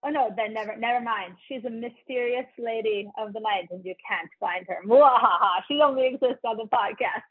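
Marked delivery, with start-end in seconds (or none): 4.9-5.6 sneering panto villain cackle; hammy evil mwahaha; villain laugh. Pretend. Mock villain laugh after mysterious lady.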